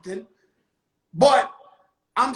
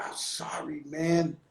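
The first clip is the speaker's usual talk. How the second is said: hushed, sheepish apology; a low, unvoiced half-whisper; 7 semitones lower, 8 dB quieter than usual. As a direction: friend apologizing the next day in a whisper. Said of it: "He said".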